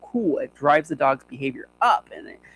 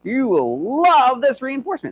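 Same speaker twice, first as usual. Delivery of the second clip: higher, slow insistent sell; mock salesy trainer; 9 semitones higher, 3 dB louder than usual. Trainer pushing a reward on the dog, overselling. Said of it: "We say, like".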